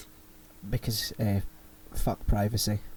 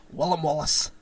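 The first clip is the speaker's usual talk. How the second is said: put-on 1 Scottish accent. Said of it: "You have to say".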